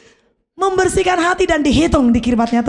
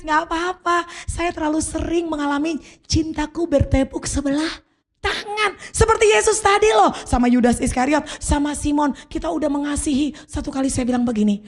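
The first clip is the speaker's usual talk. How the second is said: normal talking voice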